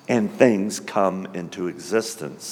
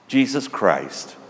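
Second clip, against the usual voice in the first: ordinary delivery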